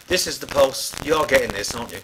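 loud and insistent, hammering the point; emphatic pitch. Retold or imitated. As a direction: smack the record company between the eyes with it. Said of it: "really smack it".

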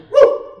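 high, loud preacher's whoop; excited whoop. Acted. Preacher whooping to the congregation, high and loud. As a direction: let out a joyful holler.

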